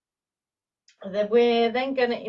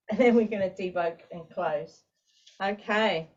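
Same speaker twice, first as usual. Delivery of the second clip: normal talking voice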